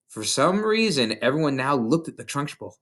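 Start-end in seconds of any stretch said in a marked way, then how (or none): none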